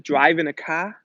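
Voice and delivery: "car" ends as "cah", no R; New England accent. Retold or imitated. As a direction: drive home the dropped R in "car", New England style. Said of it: "they couldn't pronounce the R's".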